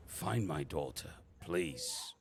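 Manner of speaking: weak, falling plea trailing to a whisper; injured man pleading. Acted. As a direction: beg softly, voice giving out on "please".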